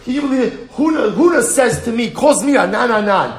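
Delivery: high, loud, laughing outrage; up in arms. Retold or imitated. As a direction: sputter with indignation, half amused. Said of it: "he's all up in arms".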